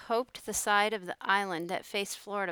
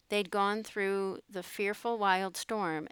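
usual speaking voice

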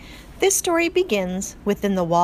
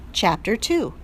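plain reading voice

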